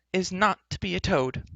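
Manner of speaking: high-pitched defiant heroine; sharp retort. Acted. Heroine refusing to be a toad, retorting. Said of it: "retorted Elenwy".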